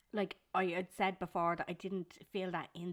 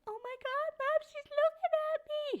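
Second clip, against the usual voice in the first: put-on much higher excited little boy voice; whiny tattling little sibling; 4 dB louder than usual. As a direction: squeal it like an eager small boy. Mum voices son about baby.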